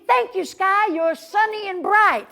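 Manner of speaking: high, loud gushing thanks to the sky; cheerful thank you. Shown as a demonstration. Host showing kids how to thank the sky, loud and bright. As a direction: sing out the thanks big. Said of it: "You gotta say, say".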